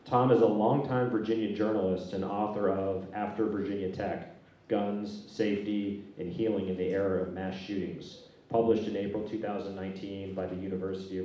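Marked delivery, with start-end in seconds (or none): none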